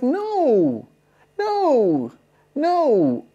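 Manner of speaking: high, loud repeated denial; high protest. Retold or imitated. To the stranger, high loud denial of being an Uber driver. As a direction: squeal the no's in protest.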